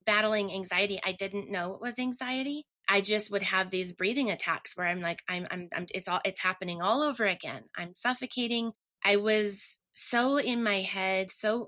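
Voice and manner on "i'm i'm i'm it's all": usual speaking voice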